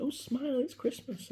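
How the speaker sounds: high sing-song mock of a lady's greeting; cheery shopper. Man imitates an Asda lady greeting friends: high chirpy call. Stranger in Asda says same line.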